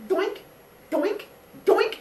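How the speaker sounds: high cartoon doinks; silly sound effect. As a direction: pop each one in like a cartoon. Pushing sons into hole.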